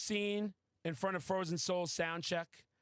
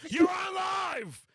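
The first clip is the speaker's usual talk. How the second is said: hammed-up live announcer shout; excited shout; 11 semitones higher, 6 dB louder than usual. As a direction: yell it like a radio host catching you live. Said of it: "I'm like".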